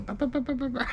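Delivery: high babbling stand-in for Samoan talk; mock Samoan chatter. Mimicking the mom and aunt talking Samoan, high babble. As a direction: babble nonsense syllables to fake a foreign language. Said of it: "they spoke in Samoan".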